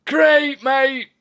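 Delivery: loud, high cheer; excited shout. Real, not acted. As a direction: shout it out happily.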